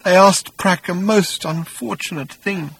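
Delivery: high-pitched, halting tearful voice; weeping. A narrator's reading. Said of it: "he said in a weeping whisper".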